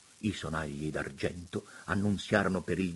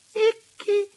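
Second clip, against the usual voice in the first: high, loud, gushing bishop's voice; high delighted exclamations of a fawning prelate; 10 semitones higher, 7 dB louder than usual. A narrator's reading. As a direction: coo over the view like a delighted old monsignor. Monsignore voiced in story.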